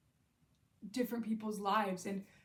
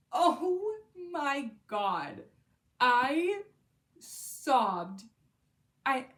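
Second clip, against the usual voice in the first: louder, higher burst of overwhelmed feeling; overwhelmed outburst; 5 semitones higher, 8 dB louder, much slower than usual. Real, not acted. To viewers recalling The Winners, overwhelmed loud outburst. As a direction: let the book knock the wind out of you.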